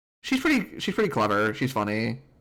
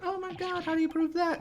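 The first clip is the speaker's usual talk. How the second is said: mock amazed game. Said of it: "the game's gonna be like".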